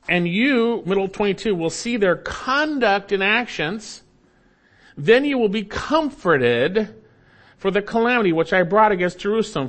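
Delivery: high, intense scripture reading; preaching. Real, not acted. Preacher reading Ezekiel to congregation, fervent proclamation. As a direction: proclaim it with rising force.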